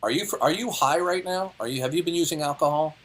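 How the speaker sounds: usual speaking voice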